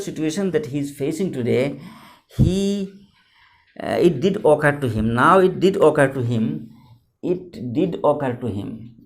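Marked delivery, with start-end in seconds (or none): 4.0-6.7 did occur to him with did hit loud; stress on did. Shown as a demonstration. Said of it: "This is very, very important". 7.2-8.9 slow, did held and stressed. Shown as a demonstration. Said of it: "This is very, very important".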